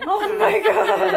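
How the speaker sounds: loud, high laughing outburst; cracking up, voice shooting up; laughing exclamation. Real, not acted. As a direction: draw it out slowly through real laughter. Friends crack up over the title.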